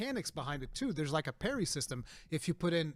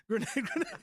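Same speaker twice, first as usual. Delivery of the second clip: high, laughing through the words; 14 semitones higher than usual. Retold or imitated. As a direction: giggle out the callout. Laughing while retelling.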